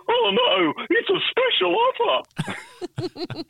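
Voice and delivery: very high, very slow squeaky voice; frightened pins crying out the deal; tiny panicked cartoon pins; cartoon bowling pins. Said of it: "the ten pins would say".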